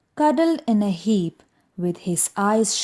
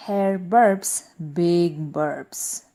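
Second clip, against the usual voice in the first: usual speaking voice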